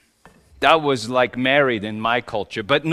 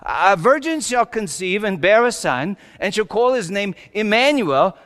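a touch of raised proclamation; prophesying; 6 semitones higher, 4 dB louder than usual. Acted. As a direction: lightly proclaim it as a prophet, pitched up. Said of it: "Isaiah the prophet prophesied".

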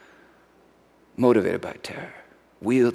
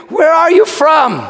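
pleading; 12 semitones higher, 4 dB louder than usual. Retold or imitated. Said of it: "I pleaded".

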